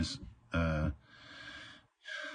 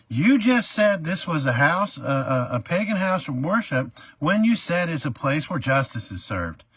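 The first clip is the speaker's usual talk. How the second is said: higher, louder accusing tirade; indignant rant; 9 semitones higher, 3 dB louder than usual. Retold or imitated. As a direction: throw his own words back at the judge. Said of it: "I went off".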